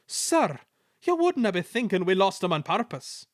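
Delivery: Scottish twins feigning hurt; Scottish twins protesting innocence to the Controller in Scottish accent. A narrator's reading.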